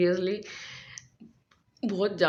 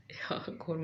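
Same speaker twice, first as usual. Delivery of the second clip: whispering; 11 dB quieter, slower than usual. Real, not acted. Early morning aside.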